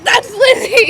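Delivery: high, loud proud shout; excited shout. Retold or imitated. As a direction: yell it out, standing up for a friend. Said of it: "I stood up and I was like".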